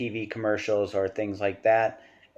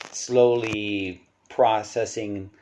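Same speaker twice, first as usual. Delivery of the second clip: slowly, stretching out each word; deliberately slow demonstration; drawn-out slowness; much slower than usual. Shown as a demonstration. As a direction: draw the words out to illustrate a slow process. Mimics slowly getting into character.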